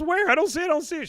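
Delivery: put-on high, panicky kid voice; Bobby. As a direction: whine loudly like a flustered boy. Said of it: "He goes".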